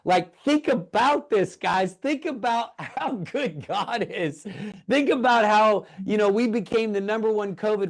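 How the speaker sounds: high, amazed and almost laughing with delight; overjoyed. Real, not acted. Pastor telling livestream viewers, overjoyed and high-pitched. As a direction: marvel at it, voice lifted high in wonder. Thrilled by government event news.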